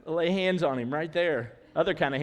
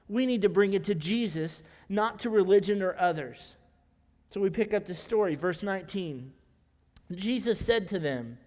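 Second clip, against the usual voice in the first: usual speaking voice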